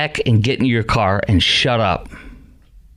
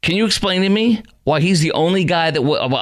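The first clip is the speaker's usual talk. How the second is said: ordinary delivery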